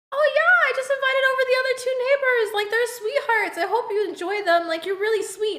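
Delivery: very high, fast, sugary neighbor voice; a high, quick mock of the neighbor bragging about inviting people; imitating the neighbor. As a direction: chirp it out like a clueless overly friendly neighbor. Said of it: "I'm like".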